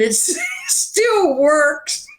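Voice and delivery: high, slow words breaking into laughter; laughing. Real, not acted. Songwriter joking about her rotary phone, laughing. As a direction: crack up on your own punchline. Laughing through own joke punchline.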